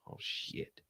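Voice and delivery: hushed, whispered curse; whispered shock. Real, not acted. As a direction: let it slip out under your breath. Shocked aside during quiet live court.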